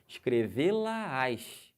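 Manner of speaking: escrevê-la-ás very slow, pitch rising on ás; drawn out, lifting the tone at ás; rise on the ending. Shown as a demonstration. Teacher showing students the pitch rise on ás. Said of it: "A subida".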